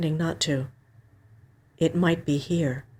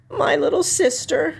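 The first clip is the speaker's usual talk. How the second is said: tearful sister; 10 semitones higher than usual. A narrator's reading.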